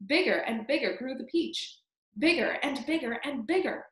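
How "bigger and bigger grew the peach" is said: plain reading voice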